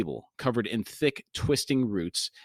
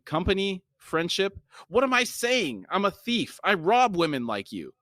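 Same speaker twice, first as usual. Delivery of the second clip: higher-pitched, agitated character read; agitated self-reproach; 9 semitones higher than usual. A narrator's reading. Thief confessing to the woman he robs, agitated. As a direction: voice lifted, questioning then exclaiming.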